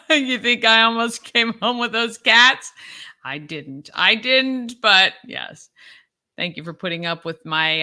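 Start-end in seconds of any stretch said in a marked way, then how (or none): 0.1-5.7 laughing. Real, not acted. Laughing about nearly adopting cats.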